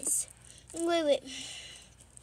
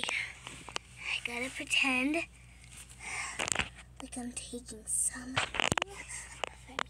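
whispering; 3 semitones lower, 6 dB quieter than usual. Acted. Sneaking, pretending to take toy.

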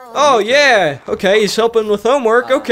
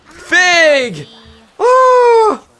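very high, louder exclamation; thrilled high-pitched outburst; excited squeal; 10 semitones higher, 4 dB louder than usual. Real, not acted. Exclaiming over child's birthday.

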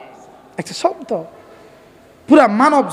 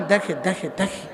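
softly, fading away; quiet answer; 6 semitones lower, 10 dB quieter than usual. Shown as a demonstration. Preacher softly answering for the crowd, fading. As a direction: let it drop low and soft. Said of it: "বলেন".

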